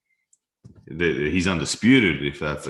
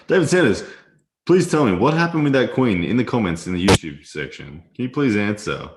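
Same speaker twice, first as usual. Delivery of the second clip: calling out; 6 semitones higher, 6 dB louder than usual. Real, not acted. Calling out to a named opponent.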